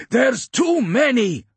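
high, slow battlefield yell; shouting. A narrator's reading. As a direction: yell it over gunfire, drawn out. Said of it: "Vaughan shouted".

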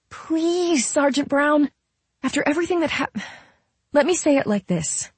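high, earnest pleading; Camille. A narrator's reading. Camille begging Sergeant Brown for the seat, pleading.

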